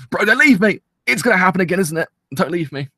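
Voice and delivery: high, loud whiny plea; mock desperate plea. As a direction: beg the show not to go, overdone. Pleading with series.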